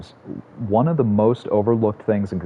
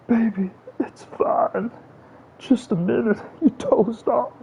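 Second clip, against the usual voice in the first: very high, drawn-out cooing voice; sweet soothing baby talk; slower than usual. Pretend. Gamer imagining cooing to Savannah, very high slow baby voice. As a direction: coo it even slower and sweeter. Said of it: "Imagine if Savannah comes in here".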